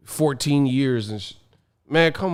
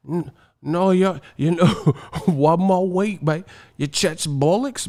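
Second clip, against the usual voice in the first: higher put-on British accent; mock British fan; 4 semitones higher than usual. British fan dismissing the talk, mock accent. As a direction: parody a protesting British fan. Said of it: "the British teeth be like".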